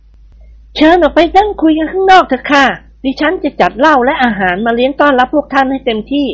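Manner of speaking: put-on bandit's young wife welcoming the guests; gracious young hostess.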